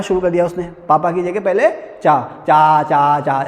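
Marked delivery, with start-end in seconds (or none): 2.0-3.4 put-on sung-out baby babble, lower; baby babbling. Shown as a demonstration. Teacher showing a toddler's first babble. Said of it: "ऐसे कहते हैं ना".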